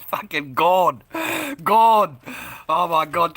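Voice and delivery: loud, laughing exclamations; can't stop laughing; loud, slow, cracking-up oh my God. Real, not acted. Still cracking up at joke.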